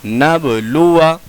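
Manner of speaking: नबलुआ with short final अ; measured नबलुआ, alif silent; correct recitation. Shown as a demonstration. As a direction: keep the last vowel of नबलुआ brief. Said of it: "लेकिन पढ़ा जाएगा".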